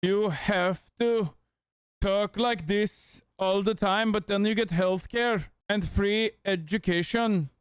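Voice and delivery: put-on slow, deliberate Norwegian accent. Norwegian invader selling free healthcare, slow Norwegian accent. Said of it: "that one was the Norwegian one".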